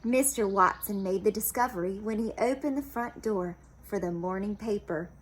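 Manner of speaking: plain reading voice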